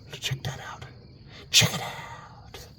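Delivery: whispering. Real, not acted. Spotting a shadow in tower.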